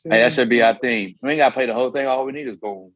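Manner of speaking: ordinary delivery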